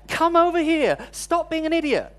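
an irritated yell across the ticket hall; retold shout. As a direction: call out sharply to his wife down the row. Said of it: "I shout to her".

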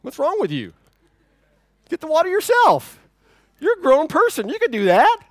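hammed-up grumpy refusal; mock exasperation. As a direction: play the put-upon husband for laughs. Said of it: "I'm like".

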